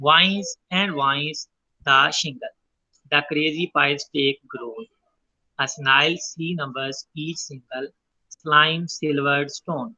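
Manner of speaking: very slow recital of the lines; slow poem reading. Shown as a demonstration. Teacher reads poem lines to the class, slow and deliberate. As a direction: let each line sit so the repeated sounds are heard. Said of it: "वा sound की जो है हमें देखने को alliteration".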